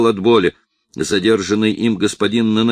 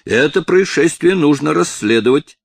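angry; 7 semitones higher, 4 dB louder than usual. A narrator's reading. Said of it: "воскликнул левый сосед в сильном гневе".

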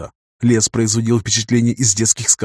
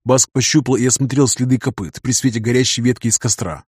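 plain reading voice

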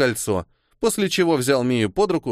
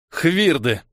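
hateful; 4 semitones higher than usual. A narrator's reading. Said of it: "с ненавистью процедил".

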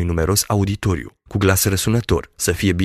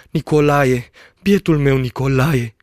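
higher-pitched distressed cry; girl's anguished cry; 6 semitones higher, 3 dB louder than usual. A narrator's reading. Girl over her sick fiancé, crying out. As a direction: call his name with aching pity. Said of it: "repeta fata".

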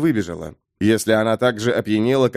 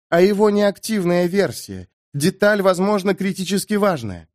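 lightly voiced woman, pitched higher; woman card reader, explaining; 7 semitones higher than usual. A narrator's reading. Amber corrects the adjutant about the card, higher woman's voice. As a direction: raise the pitch for her careful correction.

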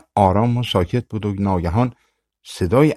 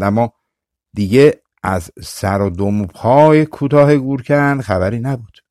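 usual speaking voice

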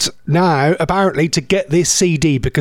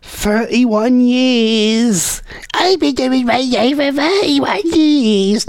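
high, very slow, drawn-out oldie voice; hammed-up old-timer voice; mock old-timer; 6 semitones higher, much slower than usual. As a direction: ham up a veteran reminiscing, stretching every word. Mock old-timer on years in radio.

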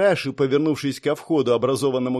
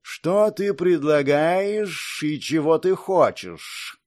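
snake guard voice; 3 semitones higher, much slower than usual. Acted. Elite guard asks.

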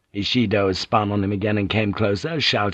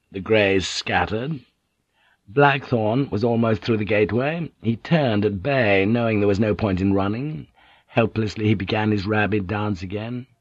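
ordinary delivery, nothing marked

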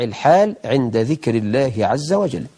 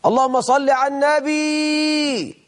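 much higher and slow, stretched out across the mosque; drawn-out loud call; 10 semitones higher, slower than usual. Retold or imitated. A man in the mosque interrupting the reciter, long high call. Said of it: "وواحد في الجامع بقى".

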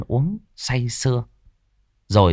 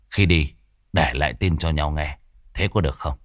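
low scheming gang leader; 6 semitones lower than usual. Acted. Tuất proposing the gang split up, low and measured. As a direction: lay out the plan calmly to the others. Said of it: "Rồi thằng Tuất mới nói".

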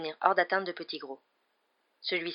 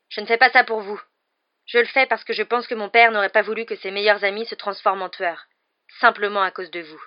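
stern rebuff; 3 semitones higher, 10 dB louder than usual. Acted. Harry rebuffs the grovelling traitor.

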